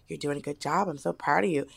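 calm a scared client softly; lightly voiced gentle piercer; soft comforting voice. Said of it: "But she was like".

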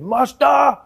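loud, high-pitched old grandfather impression; hammed-up, drawn-out plea to the master; grandfather addressing policeman. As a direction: slowly call out in a put-on elder's voice. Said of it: "my grandfather turned to him".

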